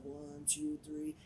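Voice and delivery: softly counted under the breath; quiet count-in. Retold or imitated. DJ counting to himself before the mix, soft count.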